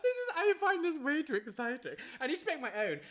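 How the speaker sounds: bubbling over with delight; excited. Real, not acted. Thrilled by on-screen alert.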